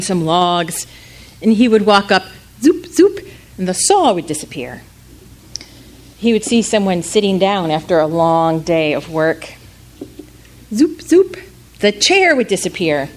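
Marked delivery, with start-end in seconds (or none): 2.6-3.2 high zoop, zoop wand effect; magic zoop sound. A narrator's reading. Father's wand making things vanish, high zoop. 10.7-11.4 high zoop, zoop wand effect; magic zoop sound. A narrator's reading. Father's wand making things vanish, high zoop.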